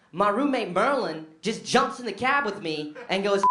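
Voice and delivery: ordinary delivery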